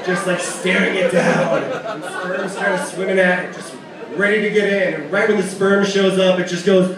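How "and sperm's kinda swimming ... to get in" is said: ordinary delivery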